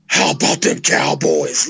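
very low, drawn-out catchphrase delivery; slow, deep impersonation of a hyped football pundit; Michael Irvin. Retold or imitated. Football fan mimicking a sweating pundit before a game, slow low impression. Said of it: "Michael Irvin, Coke nose, sweating".

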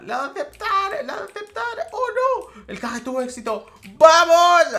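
very high squeal, then a loud shout; excited squeal. Real, not acted. Gamer celebrating the accepted trade, very high squeals. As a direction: squeal with joy and yell the win.

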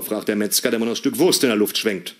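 ordinary talk

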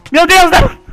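high, loud cries of protest; playful desperate yelling; panicked shouting. Pretend. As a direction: shout to be let free. Trapped in game, calls out.

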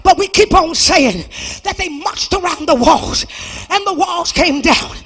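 ordinary talk, nothing marked

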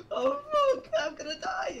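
very high whimpering panic; mock terror. Victim with a flashlight facing monsters, mock terror. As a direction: wail it like a hammed-up horror victim. Acting terrified horror movie victim.

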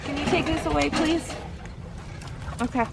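ordinary talk

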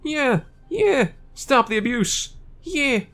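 high-pitched protester chiming in; protester heckling. Acted. Protesters backing their leader against Pokemon experiments, high heckling. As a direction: pipe up high, drawing out the chant.

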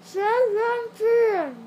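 slow loud shout with stuffed cheeks; loud stuffed-mouth shout. Real, not acted. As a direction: force it out through a full mouth. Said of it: "Say it now".

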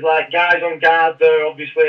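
normal talking voice

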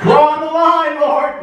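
high, loud mock protest; protesting refusal. Man refusing the Lord's order, protesting. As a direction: put your foot down, high and loud. Abraham refuses God's command.

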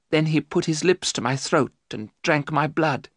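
ordinary delivery, nothing marked

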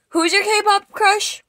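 high, loud, drawn-out question; loud insistent question. Real, not acted. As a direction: press the friend loudly for an answer.

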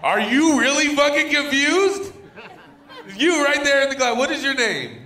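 loud, high-pitched callout at a heckler; exasperated shouting. Real, not acted. Comic calls out the woman who hasn't shut up, exasperated shouting. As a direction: shout them down, voice pushed high. Comic confronts a noisy audience member.

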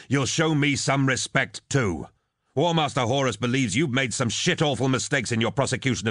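berating. Acted. Captain demanding respect from the lord, berating. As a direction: lay into the officer with authority.

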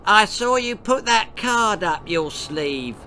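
high, loud bratty jeer; mean girl heckling. A narrator's reading. Girl shouting at the party magician, bratty jeer. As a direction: call out the trick like a spiteful brat.

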